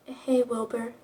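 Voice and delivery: soft, weak greeting; quiet, understated frailty; mildly shaky voice. A narrator's reading. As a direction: say it faintly, as if hurt. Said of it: "he says in a shaky voice".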